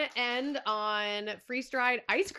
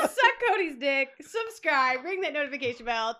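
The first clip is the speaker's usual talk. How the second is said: laughing; 2 semitones higher, 7 dB louder than usual. Real, not acted. Laughing outro repeat.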